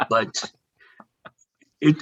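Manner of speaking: usual speaking voice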